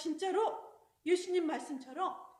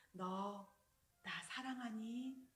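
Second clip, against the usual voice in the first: lower, softer, slow tender question; Jesus tenderly asking Peter; 5 semitones lower, 10 dB quieter, much slower than usual. Acted. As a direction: ask gently, slowly. Risen Jesus asks Peter.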